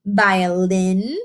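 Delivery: usual speaking voice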